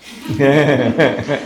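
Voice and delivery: loud burst of laughter; real laugh. Real, not acted. Speaker remembering the tutor's nickname for him, laughing.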